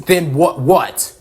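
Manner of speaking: indignant, incredulous challenge; angry interruption. Acted. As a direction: cut in sharply on the excuse. Character cuts in on excuse.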